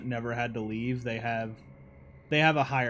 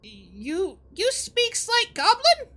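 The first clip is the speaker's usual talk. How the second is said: very high, slow squeaky goblin; curious goblin squeak; 4 dB louder, much slower than usual. Acted. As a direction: pitch it way up like a tiny goblin. GM voices goblin.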